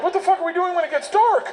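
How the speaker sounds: high, panicked question; alarmed. Acted. Narrator questions Russian gangster's plan for dark, panicked.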